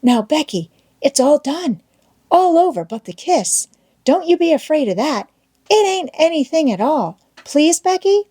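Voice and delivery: high, coaxing schoolboy plea; pleading. A narrator's reading. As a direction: coax her gently, pitch climbing to the last please. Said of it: "and pleaded".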